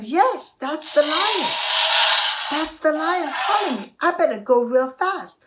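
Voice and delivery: high, loud, urgent mouse; alarmed mouse. Acted. Mouse hearing the lion's cries, alarmed. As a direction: realise it with a jolt, pitched right up.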